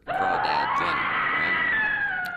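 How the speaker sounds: shouted with a laugh, pitch leaping up; excited shout. Acted. Interviewer hyping the famous guest with a laughing shout. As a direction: announce the celebrity with a laughing yell.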